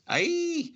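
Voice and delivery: sudden very high yelp of pain; high scream. A narrator's reading. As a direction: shriek as your hair is grabbed. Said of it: "Catspaw screams".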